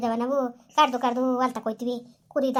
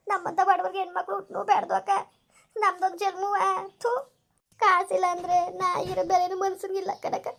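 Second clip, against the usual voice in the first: tearful lament; 5 semitones higher than usual. Acted. Mother laments to neighbour after sending daughter away, tearful higher voice. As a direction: hold back sobs while grieving.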